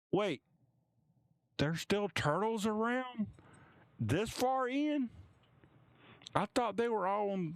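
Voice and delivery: can't believe a turtle came this far, high; amazed onlooker. Acted. Onlooker in the crowd wondering about turtles, high astonished voice. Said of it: "you do hear".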